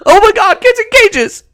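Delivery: hammed-up high shocked wail; mock shock. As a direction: squeal it like a scandalized liberal. Mocking people who complain.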